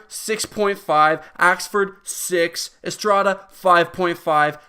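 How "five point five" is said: ordinary talk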